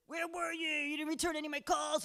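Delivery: high, insistent phone grievance; imitated complaint. Brad to her on the phone, high imitated complaint. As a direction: mimic a needy caller demanding answers.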